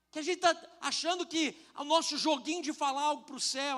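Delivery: normal talking voice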